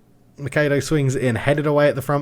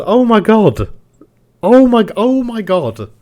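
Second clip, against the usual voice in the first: louder, higher outburst; real elated cries; bursting disbelief; 8 semitones higher, 8 dB louder than usual. Real, not acted. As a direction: it hits you all over again. Disbelief at winning the trophy.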